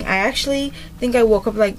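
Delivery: normal talking voice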